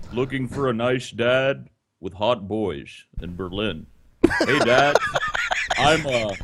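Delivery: low, deadpan reading of a personal ad; mock ad reading. Host reads a creepy personal ad aloud, low deadpan. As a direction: read it out low and sleazy-deadpan.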